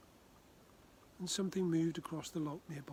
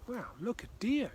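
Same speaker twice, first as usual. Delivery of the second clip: higher, louder gasp of wonder at a deer; excited exclamation; 7 semitones higher, 6 dB louder than usual. Acted. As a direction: point it out with delight. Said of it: "exclaimed Greg".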